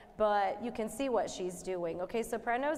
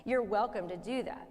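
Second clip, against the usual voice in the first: ordinary talk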